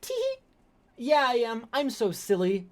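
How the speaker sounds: high, silly tee-hee voice mocking him; mocking a stalker's giggly reply; mock giggly creep. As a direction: giggle it like a coy man-child. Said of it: "And he goes".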